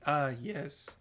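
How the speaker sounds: put-upon reply with an audible eye roll; eye-rolling disdain. Acted. Primogen replying to a whispered meeting reminder, mildly eye-rolling. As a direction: answer as if rolling your eyes. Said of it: "the kind of ultimate Toreador eye roll".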